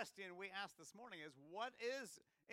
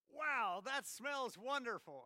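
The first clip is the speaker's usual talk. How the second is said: high, loud, slowed-down delight; delighted prince; 6 semitones higher, 7 dB louder, slower than usual. Pretend. Preacher voicing the prince at a cookout, loud delighted gushing. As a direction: savour each word like the smell hits you. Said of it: "and Prince William says".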